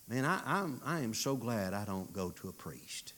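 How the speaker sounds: ordinary talk, nothing marked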